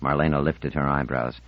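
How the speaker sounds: ordinary delivery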